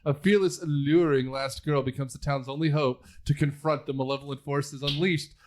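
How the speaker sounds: dramatic trailer narration; movie trailer read. Acted. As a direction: sell it like a trailer voiceover. Reads AI movie synopsis aloud.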